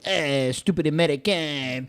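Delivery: slow, mock-disdainful French accent; hammed-up French accent, dragging the words. Host mocking Americans as a Frenchman, French accent. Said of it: "I could do French".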